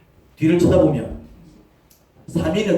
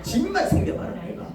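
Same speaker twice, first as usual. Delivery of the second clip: a much higher mock whine on 집만; 12 semitones higher than usual. Grown child grumbling about parents in a much higher whine. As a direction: whine 집만 up high like a sulky child.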